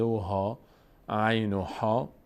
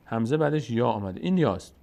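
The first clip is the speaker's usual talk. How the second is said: ordinary talk, nothing marked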